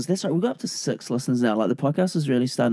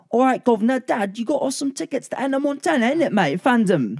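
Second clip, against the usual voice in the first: hammy London slang voice; london roadman accent; 11 semitones higher than usual. Retold or imitated. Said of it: "A flawless British accent".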